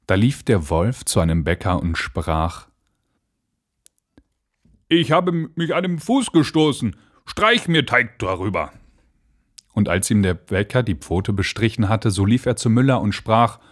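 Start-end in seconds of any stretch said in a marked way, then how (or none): 4.9-8.7 wolf's request. A narrator's reading. Wolf asks baker for dough.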